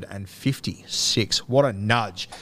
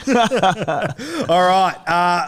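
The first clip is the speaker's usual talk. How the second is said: "All right" still laughing, high and louder; 7 semitones higher, 5 dB louder, much slower than usual. Real, not acted. Host still laughing while moving the show on, real laughter. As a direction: laugh your way into the next segment.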